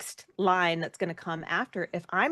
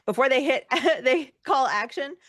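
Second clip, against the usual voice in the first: usual speaking voice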